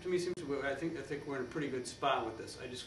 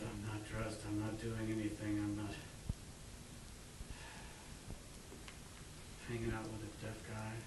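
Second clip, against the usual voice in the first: lazily undressed, idle; 2 semitones lower, 8 dB quieter than usual. Acted. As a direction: say it softly, like someone doing nothing. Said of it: "the whole feel is kind of".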